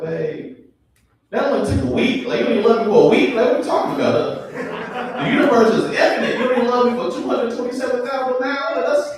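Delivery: high, loud, fast nitpicking; mock indignant protest. Comic arguing back at his girlfriend's sweet words, mock indignant. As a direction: argue as if deeply offended by the maths. Acted nerdy argument with girlfriend.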